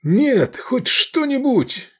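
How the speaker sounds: high, anguished begging; desperate plea. A narrator's reading. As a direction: beg frantically for anything to be done. Desperate plea over dying girl.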